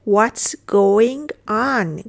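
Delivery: very slow, drawn-out words; deliberate teacher's demonstration; slow model repeat. Shown as a demonstration. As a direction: stretch each word for learners. Teacher repeats phrase slowly.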